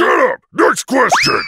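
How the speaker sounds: high, loud snapped demand; angry shout. Acted. Irritated king ordering the host to move on, shouting. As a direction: slam the topic shut. Bowser cuts off the question.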